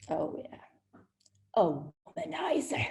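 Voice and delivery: whispered, knowing scoff; sarcastic aside. Retold or imitated. She eyes the smiling Englishman, whispering a scoff. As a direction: dismiss him under your breath.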